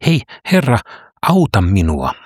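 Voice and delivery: a desperate plea to the healer; calling out. Acted. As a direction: call out for help, pleading. Woman calling out again.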